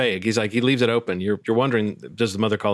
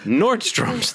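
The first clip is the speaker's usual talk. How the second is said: robot voice; 11 semitones higher than usual. Acted. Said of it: "the robot said".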